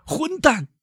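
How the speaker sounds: very high yelled curse; high furious banker shouting; shouted insult. Acted. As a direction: hurl the insult at the bandit. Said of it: "唐格拉尔嚷道".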